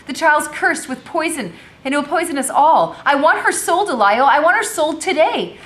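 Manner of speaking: a mistress shrieking her verdict; furious demand. Acted. Madam demands her husband sell the child, loud angry.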